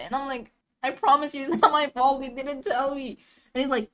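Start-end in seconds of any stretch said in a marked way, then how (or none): 0.8-3.2 high, urgent self-defense; raised, protesting retold plea; defensive pleading. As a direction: plead your innocence to the boss. Retold pleading with angry boss.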